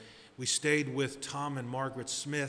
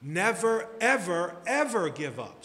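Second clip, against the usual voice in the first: emphatic preaching shout; 4 semitones higher, 5 dB louder than usual. Real, not acted. Preacher hammering the sign's message.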